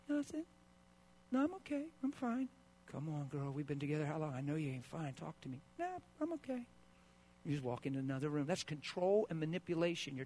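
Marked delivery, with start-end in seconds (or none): 0.1-2.5 sulking wife. Retold or imitated. 2.9-5.6 gentle coaxing. Retold or imitated. Husband pleading softly. 5.8-6.6 high, soft sulky brush-off; the silent-treatment wife, high and quiet; sulking wife. Retold or imitated.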